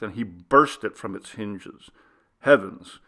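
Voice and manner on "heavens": usual speaking voice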